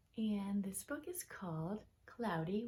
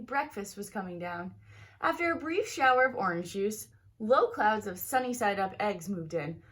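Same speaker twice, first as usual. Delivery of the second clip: lively, expressive storybook reading; reading to children; 3 semitones higher, 5 dB louder than usual. Acted. Teacher reading a picture book aloud to her students, lively storybook voice. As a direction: read it like story time for a class.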